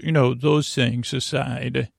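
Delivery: ordinary talk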